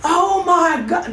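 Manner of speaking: high, slow shocked gasp; a stunned, drawn-out oh my God. Retold or imitated. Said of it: "And he said".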